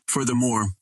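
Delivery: plain reading voice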